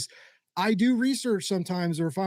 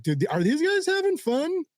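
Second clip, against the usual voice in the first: incredulous; 8 semitones higher than usual. Retold or imitated. Watching a flat podcast, baffled and incredulous. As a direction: ask it like you can't believe how dull it is. Doubting a dull podcast, retold.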